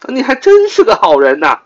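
very high, loud mock praise; sarcastic. Shown as a demonstration. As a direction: lay the irony on thick. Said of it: "我讽刺地说". (阴阳怪气地夸人)

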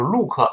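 "look" read like Chinese pinyin, tight oo; pinyin-style mispronunciation. Shown as a demonstration. Teacher mimics learners reading it as pinyin, mispronunciation. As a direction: say "look" as two pinyin syllables. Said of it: "像汉语拼音一样读成look".

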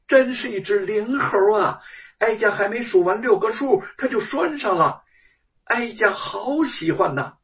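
high, bright-eyed palace lady's delight; high delighted consort voice. A narrator's reading. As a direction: gush like a pampered consort pleased with her pet. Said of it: "西宫娘娘抚掌大笑".

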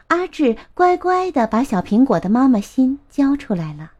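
ordinary delivery, nothing marked

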